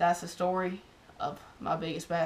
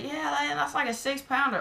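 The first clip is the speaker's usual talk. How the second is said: higher, mock casual guy voice; imitating a dude; 8 semitones higher than usual. Guy across the pond lowballs the fish, higher mock dude voice. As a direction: play the laid-back fisherman across the pond.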